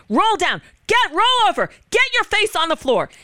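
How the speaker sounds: high, urgent retold shout of commands; imitated police yelling. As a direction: mimic officers yelling orders at a man. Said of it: "they're yelling at him".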